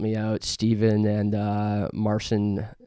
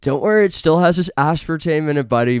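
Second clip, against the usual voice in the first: mock patriotic boast; 6 semitones higher, 6 dB louder, faster than usual. Continues mock Canadian boast.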